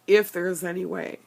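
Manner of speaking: ordinary talk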